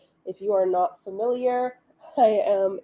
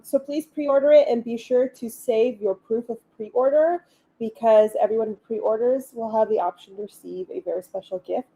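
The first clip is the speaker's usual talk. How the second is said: ordinary delivery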